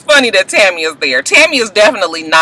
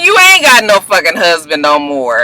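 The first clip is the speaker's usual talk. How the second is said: ordinary delivery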